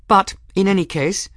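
put-on high-pitched, composed young woman; Bridget, firm and formal. A narrator's reading. Young woman answering the master of arms, firm. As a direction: state the case firmly, pitched high.

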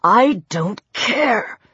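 slow, drawn-out menace; cold menace. A narrator's reading. Peter dismisses Lang's plea, slow and menacing. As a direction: draw it out through bared teeth.

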